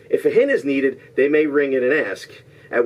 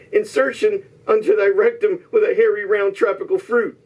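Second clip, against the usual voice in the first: higher-pitched theatrical quote reading; mock-Shakespearean declaiming; 9 semitones higher than usual. Host reads contestants a disguised movie line, mock-Shakespearean declaiming. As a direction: declaim it as lofty verse.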